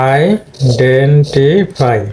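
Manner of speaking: Identify very slow, four syllables; four-syllable demo. Shown as a demonstration. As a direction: count out identify slowly. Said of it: "four syllabic word". (চার সিলেবলে খুব ধীরে identify)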